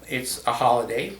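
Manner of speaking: ordinary delivery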